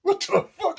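very high, loud mock disbelief; mock shock. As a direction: squeal it like a stunned onlooker. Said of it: "You looking at that motherfucker going".

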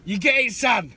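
loud, high mock London geezer; hammed-up London accent, belted out. Checking his mate follows, mock London accent. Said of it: "You should put a London accent in".

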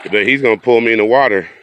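usual speaking voice

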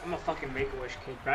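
ordinary delivery

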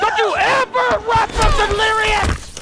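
high, loud threat; menacing shout. Acted. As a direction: yell at the fleeing victim. Killer yells at victim.

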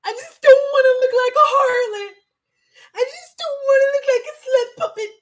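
high, loud, hammed-up crying whine; mock sobbing plea to her husband, high and loud; whiny mock wail. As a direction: wail it like a fake-sobbing drama queen. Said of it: "And I'll be like".

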